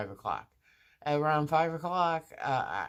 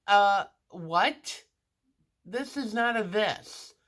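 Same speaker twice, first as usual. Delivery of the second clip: higher, louder huffy what; indignant disbelief; 7 semitones higher, 5 dB louder, slower than usual. Retold or imitated. Patient to confused orderly, indignant and raised. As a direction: scoff at him, pitched up.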